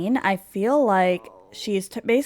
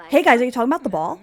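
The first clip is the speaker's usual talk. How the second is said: loud, higher, very fast chirp; acted eager daughter; 4 semitones higher, 6 dB louder, much faster than usual. Daughter interrupting about the ball, quick and eager. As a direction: burst in eagerly, words tumbling out.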